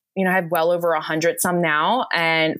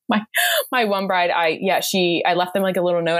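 ordinary talk, nothing marked

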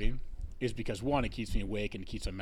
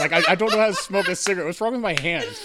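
laughing; 8 semitones higher, 12 dB louder than usual. Real, not acted. Flustered, laughing, can't hold cigarette.

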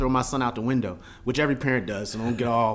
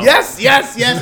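high, shouted yeses; excited agreement; 8 semitones higher, 11 dB louder, slower than usual. Real, not acted. Agreeing loudly with a fellow parent, excited shout.

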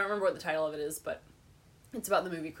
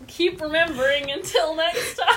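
nervous; 9 semitones higher, 9 dB louder, slower than usual. Real, not acted. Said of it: "You look so nervous".

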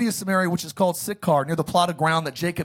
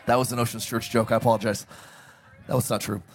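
ordinary talk

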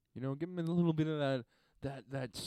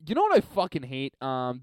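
ordinary delivery

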